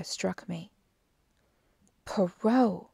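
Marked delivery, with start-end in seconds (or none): 2.0-2.9 louder exclamation of the name; a touch of sudden realisation; mild cry. A narrator's reading. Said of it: "I cried".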